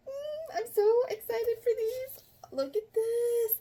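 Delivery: high, thrilled gushing; excited squeal. Real, not acted. Vlogger gushing at the next book, high excited squeal. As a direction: squeak with delight.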